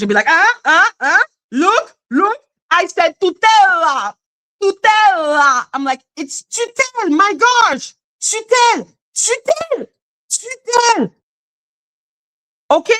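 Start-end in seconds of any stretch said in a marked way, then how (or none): 0.3-5.7 mocking clumsy French learners. Weirdos trying to say one word in French, mocking high imitation. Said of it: "try to sp-, to say one word in French". 6.2-11.1 "tutelle" said high, with the French front U and a crisp final L; exasperated high correction: "tutelle" with French Y vowel; corrective French pronunciation. Shown as a demonstration. French speaker correcting bad learners, exasperated high "tutelle". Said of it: "It's the Y and the alphabetic".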